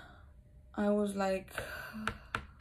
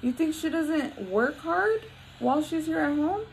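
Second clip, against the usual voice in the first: pointed retort; 7 semitones higher than usual. Retold or imitated. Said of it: "And I'm like".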